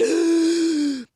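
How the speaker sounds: loud high startled gasp; sharp gasp. Retold or imitated. Naomi hears the scream and gasps loudly. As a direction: gasp in sudden alarm.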